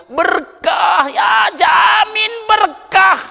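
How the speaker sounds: high, loud, drawn-out blessing; preacher shouting the promise of blessing; promising shout. Real, not acted. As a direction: proclaim the guarantee slowly and loud.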